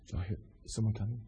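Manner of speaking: frightened whisper, barely voiced; hushed whisper. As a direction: whisper as if hiding from soldiers. Pretend hiding believers whispering.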